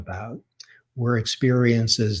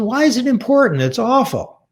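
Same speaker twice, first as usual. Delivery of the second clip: angry mother's retort; 13 semitones higher, 4 dB louder than usual. Retold or imitated. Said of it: "she was very angry".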